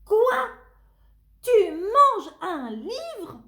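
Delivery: put-on high, loud outraged child shout. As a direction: yell at the monster to stop. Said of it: "crie Tony".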